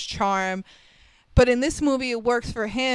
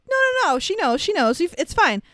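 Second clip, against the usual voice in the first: brushing off worry; 7 semitones higher, faster than usual. Retold or imitated. Paulie brushing off repeated question.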